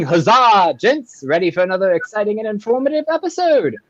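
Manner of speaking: high, put-on English accent. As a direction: read it out in high mock English. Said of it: "Let me know how my English accent".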